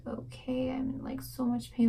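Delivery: breathy, whispered words of pain; weak pained reply. Retold or imitated. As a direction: whisper it as if barely able to speak. Weakly telling nurse she hurts.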